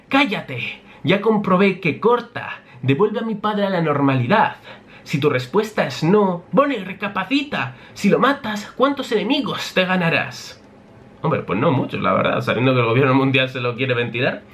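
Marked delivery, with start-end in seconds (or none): 0.1-10.6 put-on higher, hot-headed girl's voice; Bonnie threatening. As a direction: threaten him with the sabre.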